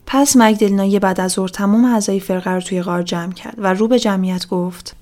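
usual speaking voice

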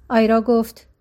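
ordinary delivery

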